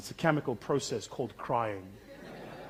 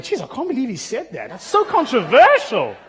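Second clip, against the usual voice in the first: mock-scandalised gossip voice, higher and louder, slowing at the end; gossiping bystanders; 11 semitones higher, 14 dB louder than usual. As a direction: imitate shocked people gossiping outside. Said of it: "outside they were going".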